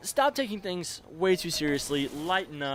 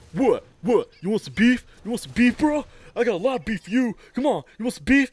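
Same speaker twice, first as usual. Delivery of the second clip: high, loud mock tough-kid challenge; aggressive kid taunt; 7 semitones higher, 9 dB louder than usual. Kid squaring up to the narrator, loud taunting challenge. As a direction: bark it like a riled-up kid itching to fight.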